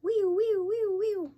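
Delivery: high, loud wee-oo siren wail; siren imitation. Shown as a demonstration. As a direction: howl it like a fire engine siren. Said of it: "That's not how it really sounds, but it's the best I can do".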